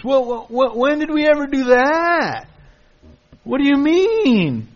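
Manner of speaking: high, whiny feigned innocence; mock innocent excuse. Preacher mimicking Israel's excuses, high and whiny. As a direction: play the people dodging blame. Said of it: "It's like our children".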